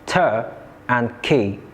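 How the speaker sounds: slow, separated "ter and key"; split into syllables. Shown as a demonstration. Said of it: "This word has two syllables".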